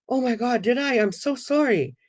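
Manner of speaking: high, embarrassed and sorry; flustered apology. Retold or imitated. Clerk apologizing for her angry eyes, flustered. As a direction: gush out a sheepish sorry.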